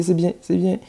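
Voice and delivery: ordinary talk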